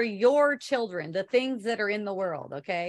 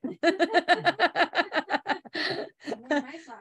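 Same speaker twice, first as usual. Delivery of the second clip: high, rippling run of giggles; real laugh; 5 semitones higher than usual. Real, not acted. Speaker teased by Leia over socks, giggling. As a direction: keep giggling after being caught out.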